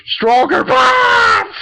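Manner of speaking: high, loud, drawn-out yell; hammed-up attack shout; mock battle cry. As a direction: holler it slowly like a battle cry. Acting out the fighter's cry.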